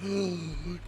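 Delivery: sharp shocked gasp. Retold or imitated. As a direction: gasp in mock horror. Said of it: "the teacher just being like".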